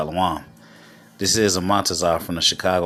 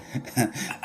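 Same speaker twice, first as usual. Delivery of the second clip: soft chuckle; short, quiet laugh to oneself; 2 semitones higher, 8 dB quieter than usual. Real, not acted. Chuckles after the played story.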